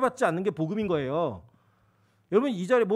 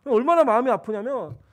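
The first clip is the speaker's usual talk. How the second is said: ordinary talk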